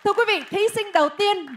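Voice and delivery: loud, high announcement building to a shouted name; big-reveal announcer. Real, not acted. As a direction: build suspense, then belt out the winner. Announces a finalist to the crowd. (giọng MC công bố kết quả, hô vang tên)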